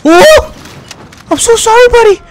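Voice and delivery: high, loud yelp of a sorry; startled apology. Real, not acted. Gamer apologizing to a teammate, high and startled. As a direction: shriek out an apology to the buddy. Teammate accidentally killed in game.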